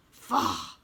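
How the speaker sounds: a low scornful grunt of Faugh; scornful exclamation. A narrator's reading. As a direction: scoff it off. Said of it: "exclaimed Hold Your Nose Billy".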